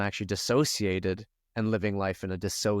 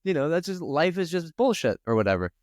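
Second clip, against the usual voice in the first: mocking past self; 9 semitones higher than usual. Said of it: "And I just thought".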